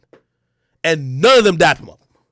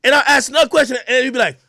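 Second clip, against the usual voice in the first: normal talking voice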